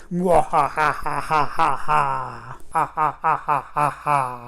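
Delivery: villain's evil laugh; evil cackle. Acted.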